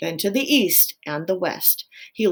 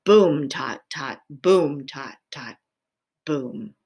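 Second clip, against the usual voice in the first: put-on low drum pattern, accented booms; imitated drumbeat; 4 semitones lower, 4 dB louder, slower than usual. A narrator's reading. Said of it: "One long beat with his tail".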